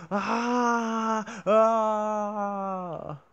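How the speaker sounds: loud high scream. Retold or imitated.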